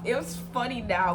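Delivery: usual speaking voice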